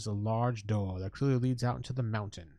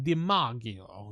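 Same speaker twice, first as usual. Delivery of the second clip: Italian accent; much slower than usual. Shown as a demonstration. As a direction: Di Maggio said slowly with Italian flair. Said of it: "I wanna say it like an Italian".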